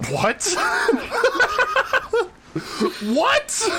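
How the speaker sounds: real shock breaking into laughter. Real, not acted. Host reacts to the testicles punchline, shocked and laughing.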